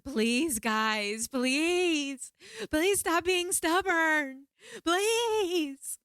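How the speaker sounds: high, loud, desperate begging scream; reenacted screaming plea. Begging the spirits during the ceremony, high frantic screaming plea. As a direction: beg them at the top of your voice, pitch climbing. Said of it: "I start screaming".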